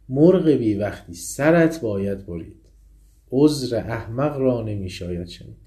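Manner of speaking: reciting the lion's sentence; poem recitation. Acted. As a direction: declaim the verdict firmly.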